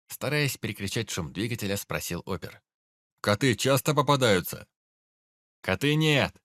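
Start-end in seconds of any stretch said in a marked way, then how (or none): 3.2-4.7 loud, high call over engine; shouting over machinery. Acted. 5.6-6.4 loud, high shout back; shouting over machinery. Acted. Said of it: "заорал в ответ пёс".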